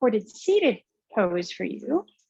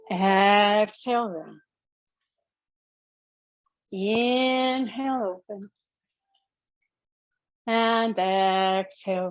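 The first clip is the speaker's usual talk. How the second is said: slow, drawn-out yoga breath cueing; slow breath cues; slower than usual. Acted. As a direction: stretch each breath word out slowly.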